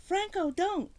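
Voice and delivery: high, loud cry of alarm; cried out. A narrator's reading. The woman to Franco, stopping him, a loud high cry. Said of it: "the woman cried out".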